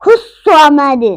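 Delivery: loud, slow खुस्वमद with a thick, rounded S; heavy Swad. Shown as a demonstration. Teacher models the letter Swad, heavy खुस्वमद. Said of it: "स्वद को पुर पढ़ेंगे".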